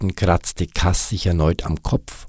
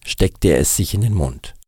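plain reading voice

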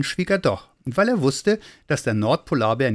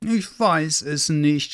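slow, higher-pitched tearful wail; little Santa sobbing in despair; 5 semitones higher, much slower than usual. A narrator's reading. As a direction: whimper it helplessly, drawn out. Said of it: "schluchzte er".

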